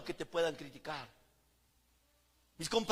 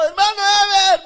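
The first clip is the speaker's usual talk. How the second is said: much higher, louder call; 14 semitones higher, 19 dB louder, faster than usual. Retold or imitated. Sister Flor calling him again in public, loud higher call. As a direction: hail him again boldly. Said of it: "me dice".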